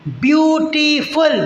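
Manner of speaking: ब्यूटीफुल drawn out slowly, beat by beat; split into syllables. Shown as a demonstration. Teacher shows students the beats of ब्यूटीफुल, slow and split. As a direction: sound out ब्यूटीफुल as three separate beats. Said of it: "ब्यूटीफुल तीन बार में बोल पा रहे हैं".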